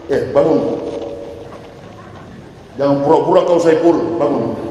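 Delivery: a hint of a gruff angel waking a sinner; rough angel. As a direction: rouse him roughly like a kicking angel. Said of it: "Munkar Nakir pakai kaki".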